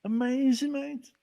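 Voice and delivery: rising high with delight; amazing, mate, voice climbing; excited. Real, not acted. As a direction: burst out thrilled. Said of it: "how did that make you feel".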